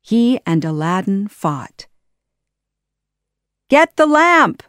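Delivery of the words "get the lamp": higher, louder urgent call; urgent shout. Acted. Aladdin shouting to Jasmine, urgent shout. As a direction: yell it across the fight. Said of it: "Aladdin shouted to Jasmine".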